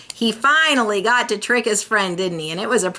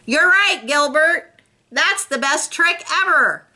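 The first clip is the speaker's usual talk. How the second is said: loudly; 8 semitones higher than usual. Acted. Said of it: "Patty said loudly".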